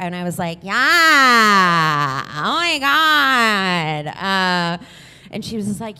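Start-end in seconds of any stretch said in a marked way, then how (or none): 0.6-4.1 long, drawn-out exclamation; feigned amazed agreement. Retold or imitated. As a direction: stretch each word in mock sympathy.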